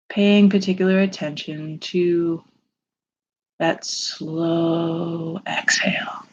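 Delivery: slow, soothing meditation voice with long pauses; unhurried, calming lead through a visualization; guided meditation. Acted.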